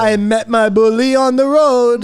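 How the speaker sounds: put-on high, loud mixed accent; hammed-up mixed accent; mock Jamaican accent.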